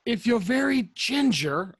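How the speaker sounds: high, loud, slow stage whisper; exaggerated in-character whisper; mock stage whisper. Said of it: "I whisper".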